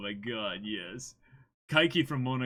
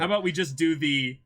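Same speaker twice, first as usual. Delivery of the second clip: normal talking voice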